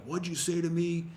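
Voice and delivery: a hint of the hard guy demanding an answer; hard guy confronting. Shoveler following a passer-by, lightly mimicked confrontation. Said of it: "he was a pretty hard guy".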